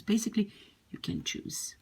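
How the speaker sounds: soft unvoiced whisper; whispered aside. Real, not acted. Teacher whispering to learners that they can choose. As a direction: drop to a whisper for the reassurance.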